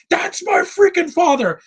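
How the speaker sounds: high-pitched mock yell of the hero; retold shout. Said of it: "the scene where".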